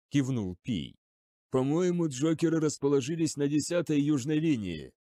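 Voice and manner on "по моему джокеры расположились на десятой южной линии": plain reading voice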